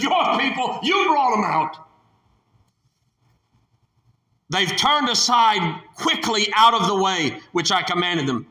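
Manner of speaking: high, pointed blame on your; accusing God. Acted. God blaming Moses for the people, high and accusing.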